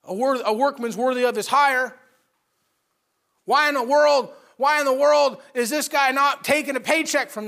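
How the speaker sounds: loud, high, exasperated protest; indignant complaint. Acted. Gehazi complaining that Elisha refused the money, loud indignant rant. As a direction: rant about the lost paycheck. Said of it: "he goes".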